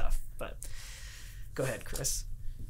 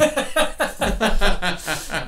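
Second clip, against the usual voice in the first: big, high burst of laughter; loud laugh; 6 semitones higher, 6 dB louder than usual. Real, not acted. Host cracking up at the fall clip, loud laugh. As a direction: laugh out loud, falling away.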